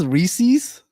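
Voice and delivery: Reese's said as REE-seez; Reese's rhyming with "pieces" spoken as REE-seez; Reese-eez. Shown as a demonstration. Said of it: "is the way you pronounce this".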